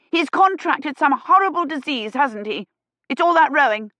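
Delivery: indignant fuss. A narrator's reading. Busybody charges in accusing, high and loud. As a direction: fuss loudly like a meddler. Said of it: "steaming down the corridor".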